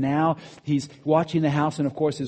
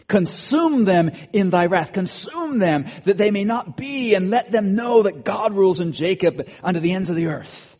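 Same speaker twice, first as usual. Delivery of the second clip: forceful scripture reading; 5 semitones higher, 6 dB louder than usual. Acted. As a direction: proclaim the psalm with full force. Preacher reads a cursing psalm.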